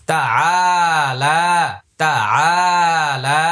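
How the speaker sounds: তা আ লা with long vowels after a short first syllable; one-alif stretch. Shown as a demonstration. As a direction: draw out "আ" and "লা" in তা আ লা. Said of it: "এটা এক আলিফ টানতে হবে". (তা আ লা এক আলিফ টেনে পড়া)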